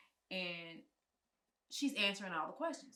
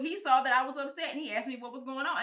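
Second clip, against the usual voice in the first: louder, higher, defending herself to the mother-in-law; wife answering her mother-in-law, loud and raised; heated retort; 6 semitones higher, 12 dB louder than usual. Retold or imitated. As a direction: push back hard at her.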